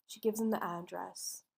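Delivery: ordinary talk, nothing marked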